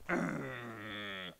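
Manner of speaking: long low throaty rumble; sustained pretend growl; dragon growl. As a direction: rumble menacingly like a beast. Dragon glaring at its rival.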